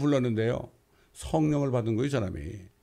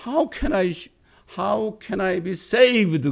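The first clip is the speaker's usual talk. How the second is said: high, urgent mock-tearful cry; loud, drawn-out imitation of pleading; retold tearful plea; 6 semitones higher, 4 dB louder, much slower than usual. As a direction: imitate a desperate plea, stretched out and raised. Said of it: "막 그냥 눈물을 흘리면서".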